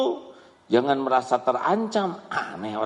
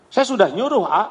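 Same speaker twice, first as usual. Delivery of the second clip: higher, louder defensive protest; indignant parent; 8 semitones higher, 6 dB louder, faster than usual. Acted. Parent snapping back at the preacher, indignant. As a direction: push back, offended.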